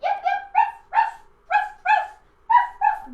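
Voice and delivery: very high loud yapping; shrill little yips and ruffs; little yappy dog. Shown as a demonstration. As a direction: yap like a tiny dog. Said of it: "be the little yappy dog".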